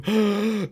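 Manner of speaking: sharp, high excited gasp. Shown as a demonstration. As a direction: gasp like an overexcited owner. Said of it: "not going".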